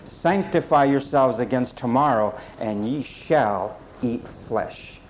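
weighty warning, slowing right down on the last words; ominous reading. Shown as a demonstration. Preacher voices God's warning to the complainers, slow and ominous. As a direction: draw out "shall eat flesh" low and falling. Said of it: "Let's read it this way".

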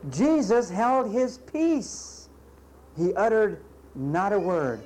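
slow solemn emphasis; slow emphatic. Acted. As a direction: let each word land slowly. Preacher stresses Jesus's silence.

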